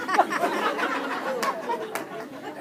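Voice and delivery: high burst of laughter; real laugh. Real, not acted. Preacher laughing at his scare story, real laughter. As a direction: laugh out at his own joke.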